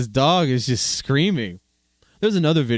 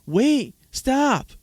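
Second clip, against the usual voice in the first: much higher, louder call after the balloon; mother yelling; 3 dB louder than usual. Retold or imitated. The mother yelling after the floating balloon, high mock shout. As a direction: call out "stop" like a frantic mother. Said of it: "the mother was yelling".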